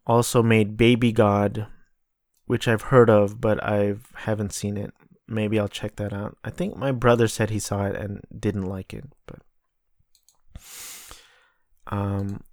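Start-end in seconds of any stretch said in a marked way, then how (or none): none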